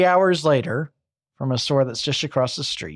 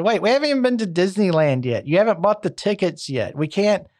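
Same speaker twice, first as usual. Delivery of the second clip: higher, hurried, incredulous objection; shocked protest; 4 semitones higher than usual. Retold or imitated. Shocked wife objecting to leaving vacation early, higher protest. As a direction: protest in disbelief, pitched up. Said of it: "Joan was shocked at this".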